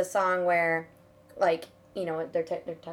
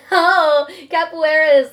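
excited; 8 semitones higher, 13 dB louder, slower than usual. Real, not acted. Laughing correction.

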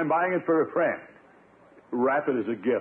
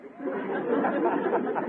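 high, unvoiced embarrassed titter; nervous chuckle; 10 semitones higher than usual. Uncle laughs off the cook's remarks with a nervous titter. Bertie mocks his shape.

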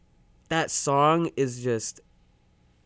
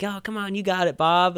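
lightly voiced coaxing, raised pitch; a hint of egging someone on, pitched up; mock encouragement; 7 semitones higher than usual. Booth crew cheering on a struggling singer, lightly imitated encouragement.

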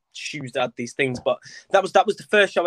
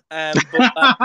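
normal talking voice

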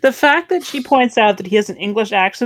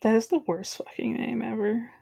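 whisper; 11 dB quieter than usual. Real, not acted. Whispered asides.

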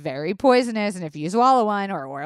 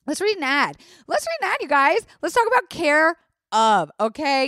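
high, chirpy announcement; hammed-up ad intro; 7 semitones higher, 4 dB louder than usual. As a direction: hype up the sponsor with mock enthusiasm. Hyping up the ad read.

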